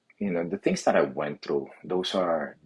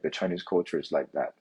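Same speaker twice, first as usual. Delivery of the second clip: ordinary delivery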